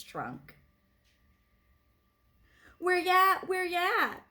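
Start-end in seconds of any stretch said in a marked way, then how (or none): 2.8-4.2 high, loud street call; a call ringing down the street. A narrator's reading. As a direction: sing it out to the parade crowd. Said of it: "musicians would call".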